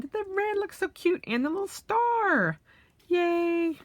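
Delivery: cutesy high voice. Real, not acted. Admiring cute details.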